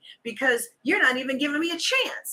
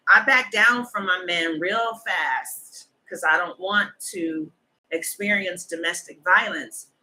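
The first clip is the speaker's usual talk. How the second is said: ordinary delivery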